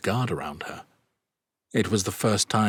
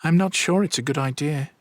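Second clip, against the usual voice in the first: higher, anxious mother; worried; 9 semitones higher, 3 dB louder than usual. A narrator's reading. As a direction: voice lifted with worry. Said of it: "sounding worried".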